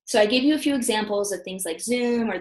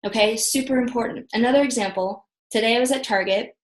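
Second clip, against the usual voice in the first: usual speaking voice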